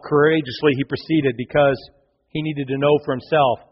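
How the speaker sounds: usual speaking voice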